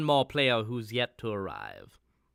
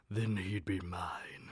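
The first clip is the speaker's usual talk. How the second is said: soft menacing whisper; sinister whisper; 7 semitones lower, 10 dB quieter than usual. Acted. Butler plotting against the detective, a soft sinister whisper. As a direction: hiss the threat under breath. Villain plots the detective's doom.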